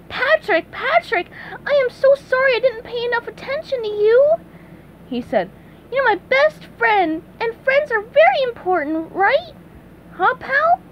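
high, pleading cartoon sponge; SpongeBob voice. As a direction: apologize warmly and eagerly. SpongeBob apologizes to Patrick.

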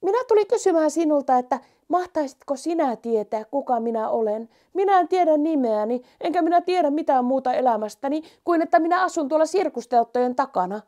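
high, quick and eager mouse; a lost little mouse asking, a bit fast. Acted. Mouse voiced in the story. (hiiren korkea, innokas ääni)

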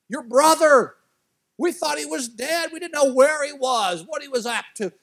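high, loud, breathless news; excited servant. Acted. Servant telling the older son his brother is back, excited and high. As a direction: burst with the good news.

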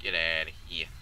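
low-pitched get outta here; low, restrained brush-off; low dismissal. Acted. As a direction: send them away in a low voice. Dismissal said low, then yelled.

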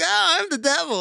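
high, hammed-up devil voice; mock devil. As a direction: squeal it like a goofy Halloween devil.

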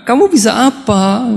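high, slow mock interrogation; a lightly voiced stern father demanding an answer; stern would-be father-in-law. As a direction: grill the suitor slowly, half-joking. Prospective father-in-law questions suitor.

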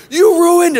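high, loud mock yell; angry yell. Imitating the owner yelling about his ruined car. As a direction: shout it at the driver in fury.

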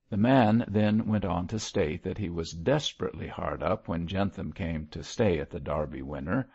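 ordinary delivery, nothing marked

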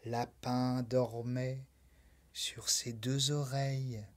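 soft bedtime hush; sleepy narration. A narrator's reading. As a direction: lull the listener to sleep.

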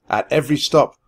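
ordinary delivery, nothing marked

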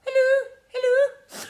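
high, loud, slow voiced dog; voicing the dog saying hello. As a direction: speak for the dog cutely. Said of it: "Luna, say hello to the children".

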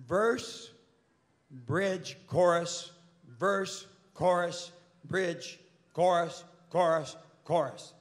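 a measured, emphatic recital of song parts; mimicking a lecturer. Record executive lecturing songwriters on structure, mock-didactic. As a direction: list each word separately, with pauses.

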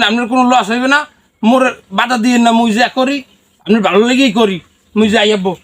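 usual speaking voice

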